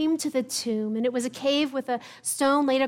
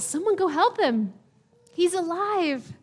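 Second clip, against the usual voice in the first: a touch of amused joy; smiling; 5 semitones higher, 4 dB louder than usual. Acted. Jesus smiling at the stunned crowd, lightly voiced and glad.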